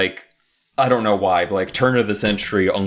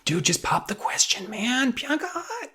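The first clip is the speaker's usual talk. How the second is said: much higher, softer mock kid voice; squeaky little-kid voice, getting higher and softer; childhood self talking to movie; 7 dB quieter than usual. Kid yelling at the movie screen, high and soft. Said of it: "I was like".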